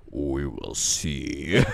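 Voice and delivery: deep, slow monster growl; scorpion voice. Retold or imitated. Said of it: "they also lower the Scorpion King's voice".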